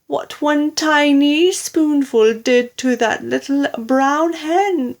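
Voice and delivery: high, loud, horrified lament; wailing. A narrator's reading. Daughter telling mother of the overdose, high wailing alarm. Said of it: "wailed Missus Cranky".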